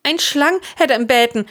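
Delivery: high, strained croak. A narrator's reading. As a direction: croak out the news. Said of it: "de Jochen kröchzen". (kröchzen, hooch)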